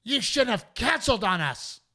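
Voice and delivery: high, put-on voice of an angry heckler; mock heckler woman. Woman in the audience scolding the comic, high mock whine. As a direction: whine it like an entitled fan. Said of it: "this woman goes".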